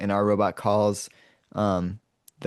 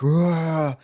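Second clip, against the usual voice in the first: a short hammed-up roar, pitched up; playful imitated roar; mock roar; 7 semitones higher, 4 dB louder than usual. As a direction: growl it out like a cartoon monster. Retelling a roar in the movie.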